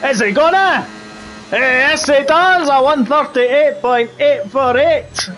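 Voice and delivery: high, thrilled Scottish race call; excited Scottish accent. Pretend. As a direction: cry out the lap time as a Scottish commentator. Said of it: "do the rest of the race in the Scottish accent".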